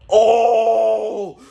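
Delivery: high sharp loud cry of shock. Retold or imitated. Boy watching his ice sword hit his friend, high shocked cry. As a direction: yelp at what you've done.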